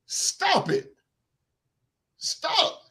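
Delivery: high-pitched, strained plea to stop; mock-pleading falsetto; exasperated high stop. Real, not acted. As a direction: squeak out stop it in disbelief. Exasperated outburst at fans.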